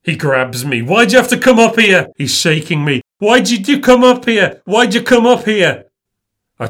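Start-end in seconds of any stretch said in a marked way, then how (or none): 0.8-2.1 high, loud frantic demand; frantic shouting. Acted. Greg grabs and shakes him. 3.2-5.9 high, loud desperate demand; frantic shouting. Acted. Greg repeats it, shaking him.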